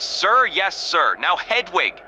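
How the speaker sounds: usual speaking voice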